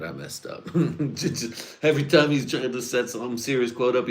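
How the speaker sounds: ordinary talk, nothing marked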